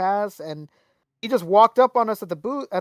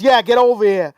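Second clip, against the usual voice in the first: eager call; 11 dB louder than usual. Retold or imitated. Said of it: "And Billy goes".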